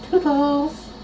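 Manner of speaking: high, sing-song goodbye; high silly voice. Pretend. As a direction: chirp it up high.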